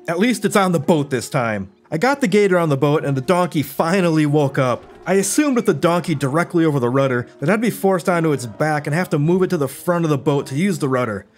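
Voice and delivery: ordinary talk, nothing marked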